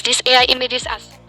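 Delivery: ordinary delivery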